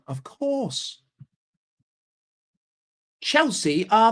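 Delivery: mock London accent, drawn out; hammed-up London accent, slow; London Chelsea fan. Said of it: "that's what they all fucking sound like".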